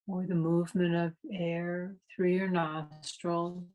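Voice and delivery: calm, unhurried meditation guide with long pauses; guided meditation. Real, not acted. Meditation teacher leading a class into breath awareness, calm guided meditation. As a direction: guide listeners through the breath in spaced-out, even phrases.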